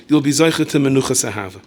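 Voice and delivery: usual speaking voice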